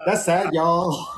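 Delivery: slow Tex-Mex twang on "That's sad, y'all."; Texas Chicano accent. Retold or imitated. Host continuing the Texas Chicano impression, slow. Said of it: "Chicano accent mixed with a little bit of a Texas twang".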